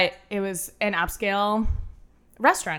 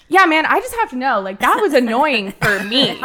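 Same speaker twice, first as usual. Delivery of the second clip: bold answer; 5 semitones higher, 7 dB louder than usual. Retold or imitated. Retelling her bold answer.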